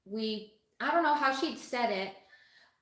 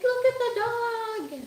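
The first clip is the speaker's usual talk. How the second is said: much higher, excited call; excited kid squeal; 12 semitones higher than usual. Retold or imitated. Kids running out to see the dog, a high excited call. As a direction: point and gush over the dog.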